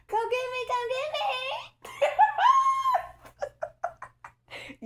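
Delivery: squealing high and laughing, sighing over him; giddy swooning. Real, not acted. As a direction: lovestruck cackling, calling him to come get her. Swooning over her destined man.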